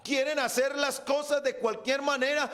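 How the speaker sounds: slow, drawn-out preacher's proclamation; fervent preaching. Real, not acted. Preacher telling the congregation their Father is holy, fervent preaching. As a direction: proclaim it forcefully, stretching the words.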